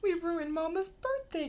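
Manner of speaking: upset monkey, read aloud; sniffly little monkey. A narrator's reading. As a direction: draw it out in dismay. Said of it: "sniffs one little monkey".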